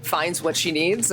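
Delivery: normal talking voice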